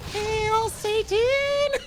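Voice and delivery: very high, drawn-out cartoon squeak on "Hail Satan!"; Miitomo voice. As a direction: stretch it out like a cute avatar. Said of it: "funny little, like, uh, Miitomo voice".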